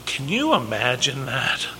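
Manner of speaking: quiet, hushed. Real, not acted. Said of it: "repeats question".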